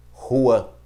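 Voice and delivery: "rua" with a throaty, J-like Spanish R; "rua" with a raspy back-of-throat R. Shown as a demonstration. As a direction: say "rua" with a strong guttural R at the start. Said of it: "que em espanhol é J".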